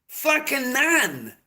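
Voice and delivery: "none" drawn out very slowly and high; emphatic stress. Real, not acted. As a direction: drag "none" out long to hammer it home. Ranting about no channel support.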